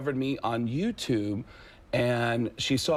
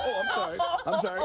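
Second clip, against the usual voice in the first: high, hurried mock apology; meek apology; faster than usual. Rejected newcomer apologising to the boss, mock meek. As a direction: back away apologising in a small high voice.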